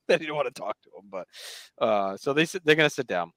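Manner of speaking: normal talking voice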